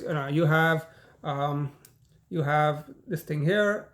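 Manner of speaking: ordinary talk, nothing marked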